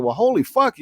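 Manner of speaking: mock shock, voice jumping up; stunned. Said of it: "I was like".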